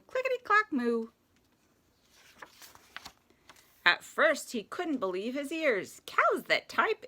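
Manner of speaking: lively rhyming picture-book read; children's story narration. A narrator's reading. Reading Little Blue Truck to children at story time, playful narration. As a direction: read it playfully with animal sounds.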